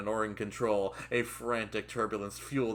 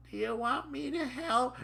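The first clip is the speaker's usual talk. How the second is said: very high, squeaky question; timid trainee voice. Acted. Trainee to fuming trainer, squeaky timid voice. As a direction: offer help in a comic high voice.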